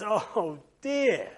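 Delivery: drawn-out sleepy moan; lazy weary groan. Acted. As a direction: sigh it like a lazybones. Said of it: "Mister Lazy says".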